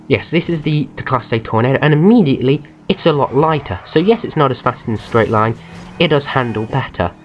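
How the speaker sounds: normal talking voice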